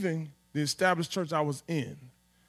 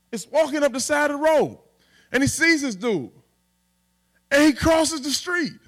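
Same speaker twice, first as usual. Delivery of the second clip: high, loud street retelling of scripture; 6 semitones higher, 5 dB louder than usual. Shown as a demonstration. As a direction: preach it like talking to the homies.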